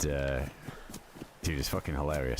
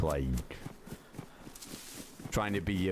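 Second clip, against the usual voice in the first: usual speaking voice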